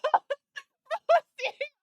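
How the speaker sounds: words squeezed out through laughter; can barely get it out for laughing. Real, not acted. Retelling the side eye, laughing hard. Laughing while telling story.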